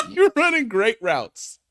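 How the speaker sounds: laughing through the line; laughing mock praise. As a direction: crack up repeating it. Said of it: "repeat laughing".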